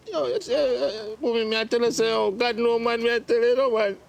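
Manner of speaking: crying complaint, rising in pitch; distraught, weeping son lamenting; tearful breakdown. Acted. Son breaks down over money.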